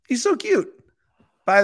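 cutesy squeal. Real, not acted. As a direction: squeal over the cute mascot. Squealing over mascot.

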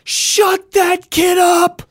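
high, loud screeching yell; furious shout. Retold or imitated. Mom snapping at a screaming kid in the cinema, shrieking. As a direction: snap at the noisy kid, screaming. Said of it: "my mom finally snaps and she goes".

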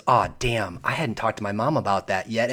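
a soft worried aside, mildly voiced; inner panic. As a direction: mutter the thought under your breath. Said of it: "my mind at that moment was".

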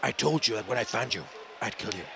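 low, soft menace, slowing on the translation; quiet film-avenger threat; Liam Neeson. Retold or imitated. Father facing the kidnapper, quietly menacing. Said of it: "I do that impression".

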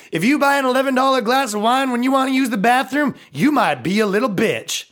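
high-pitched stand-up catchphrase delivery; Jeff Foxworthy. Acted. Comic doing a boys version of a catchphrase joke, high-pitched impression. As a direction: pitch it up like a comic setting up a punchline. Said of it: "do a boys version of Jeff Foxworthy".

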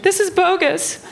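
high, aggrieved mom impression; put-on high indignant mom voice; mom's voice. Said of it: "She's like".

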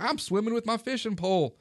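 high-pitched imitation of a goofball; silly, lifted show-off voice; goofing around. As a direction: mimic a man clowning for his friends. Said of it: "he's still laughing and goofing".

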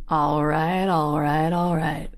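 a light take on Matthew's laid-back catchphrase; lightly voiced cool-guy drawl, easy and relaxed. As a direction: ease into it like a laid-back movie star. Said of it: "and he goes".

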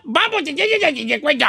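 high, unintelligible gibberish phrase; comic mush-mouthed babble; garbled nonsense talk. Pretend. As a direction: run the syllables together till nobody can follow. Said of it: "No se le entiende".

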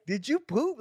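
playful question. Retold or imitated. Dad asking toddler.

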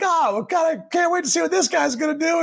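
high, sarcastic mock Dolphins fan. Mocking what Dolphins fans said about the new quarterback. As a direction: lampoon the hopeful fan way up high. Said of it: "Dolphins fans were probably like".

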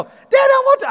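high, loud tearful shout; crying child. Retold or imitated. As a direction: cry it out like the scared boy. Crying son protesting on bull.

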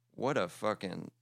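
ordinary delivery

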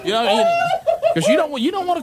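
high, slow words through laughter; cracking up mid-sentence; giggling high and drawn out; laughing while talking. Real, not acted.

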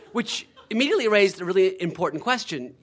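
normal talking voice

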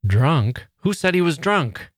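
put-on sharp rising disbelief, Who said he was drunk; hurt and furious at the word drunk; indignant little sister flaring up; indignant outburst. A narrator's reading. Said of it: "Laura turned furiously on Josie".

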